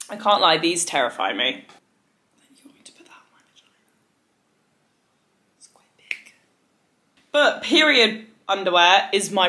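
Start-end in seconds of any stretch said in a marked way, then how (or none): 2.5-6.3 hushed aside, no voice; mock-horrified whisper to the camera. Aside about scary period underwear.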